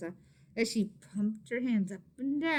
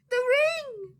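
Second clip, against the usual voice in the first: much higher, loud surprised cry; near scream; 10 dB louder than usual. Acted. Maria spotting the ring on mama's finger, near scream. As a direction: shout it in shock. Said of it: "Maria nearly screamed".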